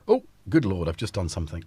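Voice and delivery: ordinary talk, nothing marked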